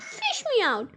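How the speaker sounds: high-pitched plea; storybook boy's high cry; shouting for help. A narrator's reading. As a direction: call out high for rescue without much volume. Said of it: "he shouted".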